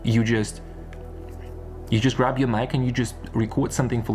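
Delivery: ordinary talk, nothing marked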